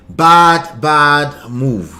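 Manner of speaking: loud mock scold, then drops; bad move. Host scolding chat after a bad admission, loud. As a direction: scold the viewer loudly.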